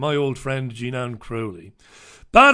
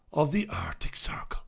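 mock-dramatic hush; 9 dB quieter than usual. Mock-dramatic reading of newspaper story.

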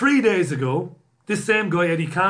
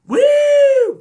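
very high, loud held "woo"; mock siren whoop; 12 dB louder than usual. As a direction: wail like a police siren. Imitates a police siren after the reply.

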